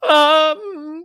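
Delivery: very high, loud, stalling mascot voice; startled crab mascot. Acted. Mascot stepping back on recognizing you, high flustered stall. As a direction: hesitate loudly in a squeaky costume-character voice. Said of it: "And he's just like".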